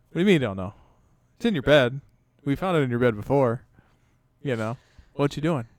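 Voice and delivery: loud, pressing dad questioning his son; stern dad. Retold or imitated. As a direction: push him for an answer, voice up. Dad confronting son in bathroom.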